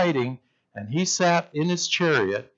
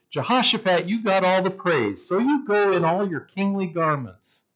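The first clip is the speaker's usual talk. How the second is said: lightly acted smooth talker, pitched higher; slick schemer; 6 semitones higher than usual. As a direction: coax someone into a scheme, with mock innocence. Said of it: "being the slick character he was".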